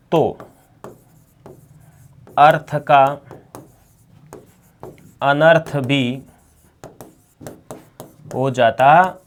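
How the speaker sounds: slow dictation in broken-up phrases. Acted. Teacher dictating notes to students, slow dictation. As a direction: read it out piece by piece for note-taking.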